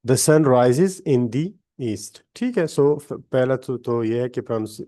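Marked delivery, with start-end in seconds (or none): 0.1-2.2 slow, spaced model reading, "the east" with a long dee; first "the" as duh, second "the" as dee; duh sun, dee east. Shown as a demonstration. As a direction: demonstrate both sounds of "the", slowly. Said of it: "so ये है".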